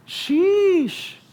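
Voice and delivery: high drawn sheesh; exclaimed sheesh. Real, not acted. Preacher worked up about hypocrisy, high sheesh.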